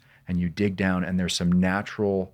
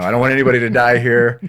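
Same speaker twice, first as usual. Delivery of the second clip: louder, fast and emphatic; excited quick punchline; emphatic joking; 4 semitones higher, 9 dB louder than usual. Real, not acted. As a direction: hammer the joke home, fast and loud. Joking about overcooking steak.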